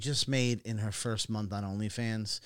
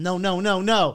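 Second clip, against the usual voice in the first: loud, raised-pitch string of protests; retold yelling; 6 semitones higher, 7 dB louder than usual. Cameraman reliving his mortified reaction, loud yell. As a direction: re-enact your own horrified yell. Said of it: "and I started yelling".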